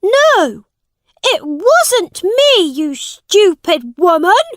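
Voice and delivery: high, loud furious yell; boy king yelling at the accusing woman, angry shout. Acted. Edward accused of theft, shouting denial. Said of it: "shouted Edward".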